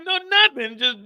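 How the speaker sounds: ordinary talk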